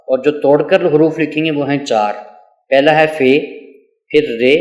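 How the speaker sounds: ordinary talk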